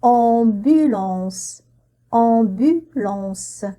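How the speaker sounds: "Ambulance" said very slowly; slow model word. Shown as a demonstration. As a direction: stretch "Ambulance" syllable by syllable. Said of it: "model word for repetition". ("Ambulance" étiré très lentement)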